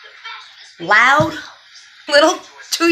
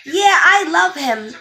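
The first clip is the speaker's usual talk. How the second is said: put-on higher-pitched little-kid enthusiasm; imitated toddler; 6 semitones higher, 4 dB louder, slower than usual. Two-year-old meeting his baby brother, imitated eager affection. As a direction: play a two-year-old gushing lovingly. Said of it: "all I kept hearing from him was".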